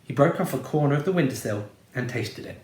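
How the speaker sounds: ordinary delivery, nothing marked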